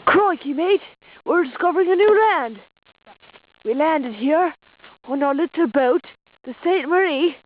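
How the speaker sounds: goofy put-on Australian voice; hammed-up Aussie explorer accent; mock Australian.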